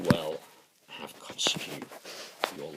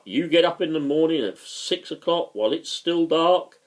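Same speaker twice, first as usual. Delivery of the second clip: louder, higher mock speechmaking; imitated political speech; 6 semitones higher, 6 dB louder than usual. Politician addressing early-rising workers, mock speechmaking. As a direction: declaim it like a speech to a crowd. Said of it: "Had the immortal speech about".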